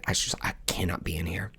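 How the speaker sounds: whispered, barely voiced; retold whisper. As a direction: breathe it out as if you can't go on. Overwhelmed beside the grave.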